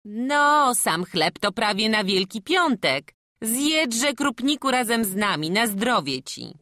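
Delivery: high, loud, bustling village woman; the farmwife urges the hungry boy to eat, high and hearty. Acted. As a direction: press food on the boy kindly and loudly.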